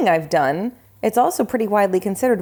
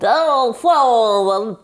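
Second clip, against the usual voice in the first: slow, garbled, swallowed name; choking on words; 2 semitones higher, 4 dB louder, slower than usual. Pretend. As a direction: mangle it as if tongue-tied. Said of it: "What are you choking on?".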